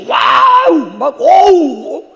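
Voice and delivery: high, loud cry of delight; joyful whoop. Pretend. 기뻐 환성을 지르시는 하나님을 흉내 내는 목사. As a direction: whoop like God rejoicing over you. God shouting for joy.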